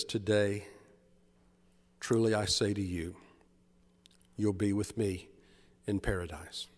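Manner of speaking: Jesus answering tenderly, low and hushed; gentle promise. Acted. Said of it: "A gentle smile crosses his face".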